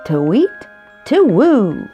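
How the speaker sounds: ordinary delivery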